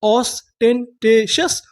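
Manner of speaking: very slow, drawn-out syllable-by-syllable reading; ऑस्टेंटेशस drawn out slowly, syllable by syllable; slow syllable demo. Shown as a demonstration. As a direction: stretch each syllable of ऑस्टेंटेशस for students. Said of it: "उसे क्या बोलते हैं English में?".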